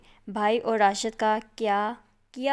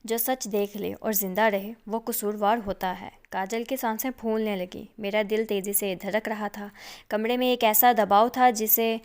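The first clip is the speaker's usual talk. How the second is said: ordinary delivery, nothing marked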